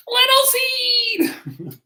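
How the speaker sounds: very high, long drawn-out call; hammed-up wail of a name; shouting. A narrator's reading. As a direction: stretch it out high like a faraway holler. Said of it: "he shouted".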